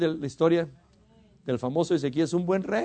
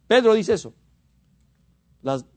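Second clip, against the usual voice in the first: ordinary talk, nothing marked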